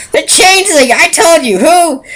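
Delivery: high, loud, fed-up yelling; guard yelling at a pony who keeps asking who, furious; exasperated shout. Acted. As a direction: snap at him, patience gone. Guard loses patience shouting.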